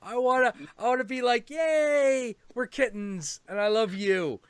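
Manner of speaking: high, gushing sweetness; eager kitten. Retold or imitated. Cat begging the other cat, gushing. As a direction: gush like a lovestruck kitten. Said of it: "He's like".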